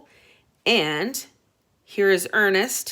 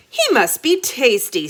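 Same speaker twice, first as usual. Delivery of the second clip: louder, greedy creature voice; hungry dragon head; 6 semitones higher, 5 dB louder than usual. Acted. Dragon Snuffle eyeing the boy, louder greedy voice. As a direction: savour it like a hungry monster. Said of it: "said Snuffle".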